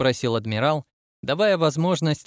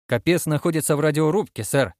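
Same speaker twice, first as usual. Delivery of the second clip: ordinary delivery, nothing marked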